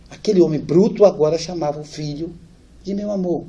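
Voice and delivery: normal talking voice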